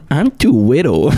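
high, teasing "widdle" voice; mock baby-talk goat. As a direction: squeak it like a tiny helpless goat.

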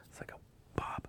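hushed, secretive whisper; urgent whisper. Retold or imitated. Golfer secretly alerting his buddy, whispered. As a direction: whisper to get a friend's attention unnoticed.

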